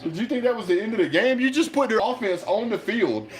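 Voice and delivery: high, incredulous scolding; exasperated rant. Real, not acted. Fan ranting at head coach's blunder, exasperated. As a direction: berate the coach in disbelief.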